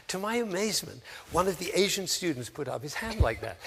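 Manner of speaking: ordinary talk, nothing marked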